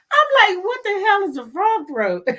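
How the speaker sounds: high voice through laughter; high laughing mock question. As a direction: squeak it out while still laughing. Retells her confusion laughing.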